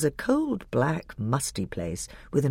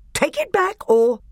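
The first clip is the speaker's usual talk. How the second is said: much higher, louder threat, cut off; higher furious threat; threatening dwarf; 6 dB louder than usual. A narrator's reading. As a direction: threaten him then break off. Nick a Brick threatening.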